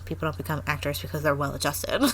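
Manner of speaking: low, quick snide aside; understated sneer, pitched low. Retold or imitated. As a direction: mutter it as a snide private thought. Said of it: "I kinda thought snidely".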